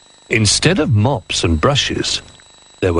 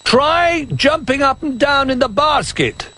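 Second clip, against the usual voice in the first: high, slow calling toy voice; Old Bear calling. Acted. Old Bear calling up to the toys, high and slow. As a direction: call up to the basket, slowly and high. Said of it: "he called".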